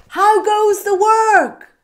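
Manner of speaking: high, loud, slow bossy call; lazy farmer calling out to the duck, high and loud. Acted. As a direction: call down lazily from bed.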